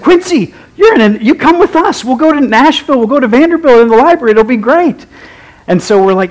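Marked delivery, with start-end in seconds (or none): 0.0-5.0 eager pitch. Retold or imitated. Students eagerly recruiting their friend.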